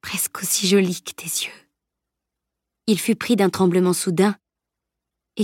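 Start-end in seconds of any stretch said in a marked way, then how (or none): 0.0-1.7 put-on soft breathy whisper of a dying boy. A narrator's reading. Dying boy to Camille, faint tender whisper. Dying boy's last words.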